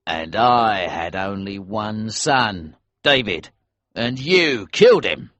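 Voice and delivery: building anger. A narrator's reading. Elsevier answers with rising anger.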